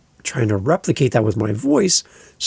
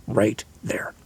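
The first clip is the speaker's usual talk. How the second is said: whisper; 4 semitones lower, 5 dB quieter than usual. Real, not acted. Dramatic turn after quoted cringe.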